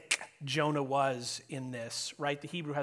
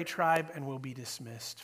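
ordinary talk

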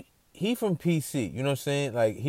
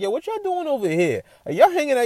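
baffled; 5 dB louder than usual. Retold or imitated. Baffled at friends being there.

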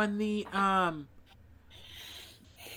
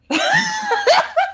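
burst of laughter; 10 dB louder than usual. Real, not acted. Laughing at own joke.

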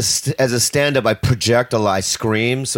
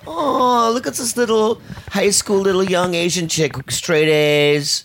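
much higher playful inner voice; high teasing voice; 8 semitones higher than usual. Retold or imitated. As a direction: pitch it up to tease. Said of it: "I'm like".

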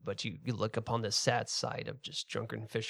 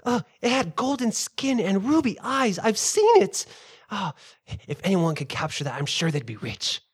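much higher, loud gossiping villager; excited villager; 9 semitones higher, 7 dB louder than usual. Acted. Villager telling friends about the beast, high and excited. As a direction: squeal it like an eager local.

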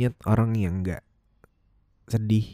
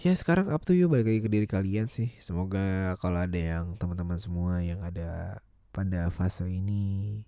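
ordinary talk, nothing marked

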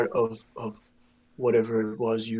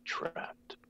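soft, halting, sinking to a whisper; eerie hushed admission; hushed confession; 7 dB quieter than usual. Acted. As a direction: confide it quietly, whisper the last word. Eerie guest confides he is trapped.

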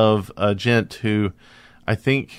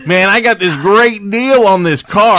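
bragging; 7 semitones higher, 7 dB louder than usual. Retold or imitated. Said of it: "You're like".

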